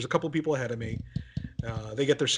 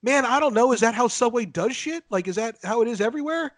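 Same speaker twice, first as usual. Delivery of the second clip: mock outburst; 8 semitones higher than usual. Narrator imagining snapping at the Subway clerk, high mock rant. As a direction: fire off the questions like losing it. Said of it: "lose it on and be like".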